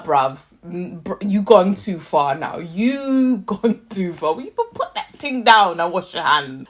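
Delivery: usual speaking voice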